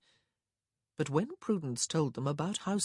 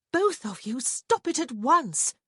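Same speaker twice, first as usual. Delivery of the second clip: scolding; 10 semitones higher, 7 dB louder than usual. A narrator's reading. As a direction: scold them firmly. Wife scolds both men.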